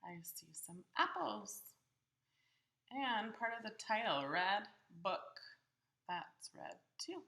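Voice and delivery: usual speaking voice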